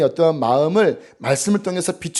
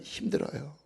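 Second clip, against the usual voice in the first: weary confession; 14 dB quieter than usual. Retold or imitated. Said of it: "이러시는 거예요".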